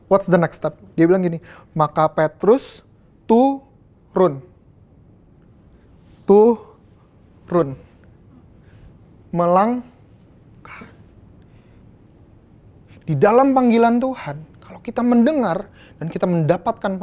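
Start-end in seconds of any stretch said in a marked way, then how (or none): 1.7-10.9 each word drawn out slowly and weighed; slow stressed words. Acted. Preacher urging the congregation to step out, slow and weighty. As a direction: lean hard on turun, then melangkah, slowly. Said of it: "preacher stresses the step".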